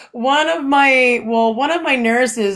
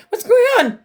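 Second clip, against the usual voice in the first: alarmed mom; 11 semitones higher, faster than usual. Retold or imitated. Mom's alarmed question.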